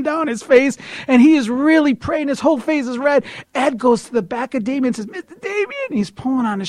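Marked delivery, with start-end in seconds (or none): 5.1-5.7 put-on high, small, eager old man. Older friend tugging the preacher's shirt, high eager call. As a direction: call for attention in a high squeaky voice. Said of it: "Ed's an older gentleman".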